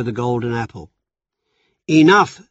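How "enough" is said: loud, sharp call for order; cry out. Acted. Zeus silencing the squabbling goddesses, loud cry. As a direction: cut through the quarrel with one loud word. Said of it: "Zeus cried".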